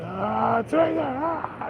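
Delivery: hammed-up warrior charge; mock battle cry. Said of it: "I said".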